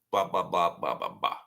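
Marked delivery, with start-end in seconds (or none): none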